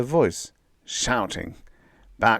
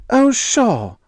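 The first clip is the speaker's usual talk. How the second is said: much higher, loud sarcastic yell; yelling back; 9 dB louder than usual. A narrator's reading. Molly yelling back at Bill while running. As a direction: shout it back at him. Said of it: "Molly yelled back".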